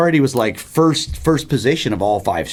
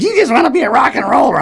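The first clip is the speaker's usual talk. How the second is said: high, fast, louder scolding mom; hammed-up motherly scolding; mock mom voice; 13 semitones higher, 5 dB louder, faster than usual. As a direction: imitate a disapproving mother, pitched way up. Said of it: "my, my mom".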